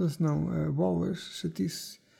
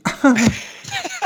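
riso alto; 10 semitones higher than usual. Shown as a demonstration. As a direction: show off your laugh, cackling. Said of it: "eu rio-me assim". (gargalhada aguda)

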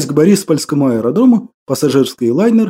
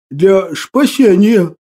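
ordinary delivery

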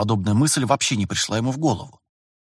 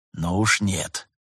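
low, firm refusal; 3 semitones lower, 4 dB quieter, slower than usual. A narrator's reading. Master refuses his trainee's idea, firm and low. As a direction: quietly put your foot down. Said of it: "твёрдо заявил Терентьев".

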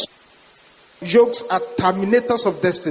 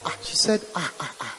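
hushed gasp; 5 semitones lower, 9 dB quieter than usual. Retold or imitated. Woman's shocked reaction retold.

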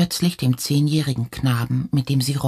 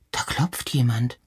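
usual speaking voice

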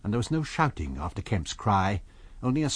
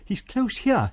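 crying out; 11 semitones higher, slower than usual. A narrator's reading. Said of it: "he cried".

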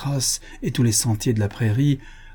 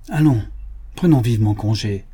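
commanding call. A narrator's reading. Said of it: "commanda Buffalo Bill".

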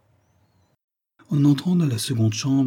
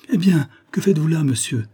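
higher, louder opening cry; indignant exclamation; 7 semitones higher, 4 dB louder than usual. A narrator's reading. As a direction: call him out, exclaiming. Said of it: "s'écria Jane".

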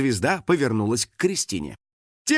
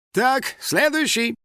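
high, drawn-out call into a crowd; 12 semitones higher, slower than usual. A narrator's reading. Star's assistant calling the next fan, shouting out. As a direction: summon the next one, slow and pitched up. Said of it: "крикнула в толпу".

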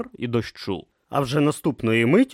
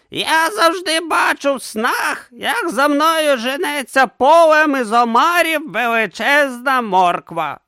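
put-on high, slow old philosopher; 6 dB louder, slower than usual. A narrator's reading. Didactylos answering Urn, high and slow. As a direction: drawl it out as the old teacher. Didactylos describes his dream.

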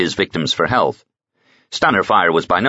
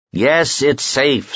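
exasperated; 8 semitones higher, much slower than usual. Acted.

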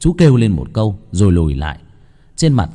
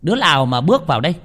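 shrill possessed shout; 7 semitones higher, faster than usual. Acted. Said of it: "tiếng của cô Ba choa choả".

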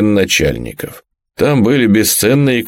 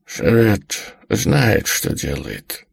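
put-on cracked, slow, breathy old whisper; creaky whisper; 6 dB quieter, much slower than usual. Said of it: "натреснуто прошептала она".

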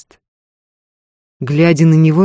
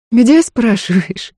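slow, higher, dryly amused retort; sparing wry amusement; 8 semitones higher, 5 dB louder, slower than usual. A narrator's reading. Wife answers Ефим's question, sparingly amused. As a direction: echo his question with a slight smirk. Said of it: "скупо усмехнулась она".

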